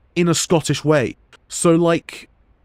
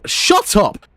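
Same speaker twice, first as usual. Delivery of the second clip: sharp, higher annoyed snap; snapping shut up; 6 semitones higher than usual. Real, not acted. Talking over the game NPC who keeps interrupting, annoyed snap. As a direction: cut the interruption off.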